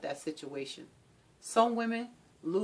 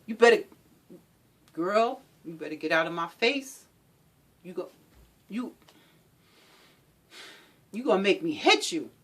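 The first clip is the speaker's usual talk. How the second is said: threatening. Acted. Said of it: "the scare tactics".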